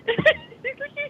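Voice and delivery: short high-pitched laugh. Real, not acted. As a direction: burst out laughing, high and bright. Laughs at her own story.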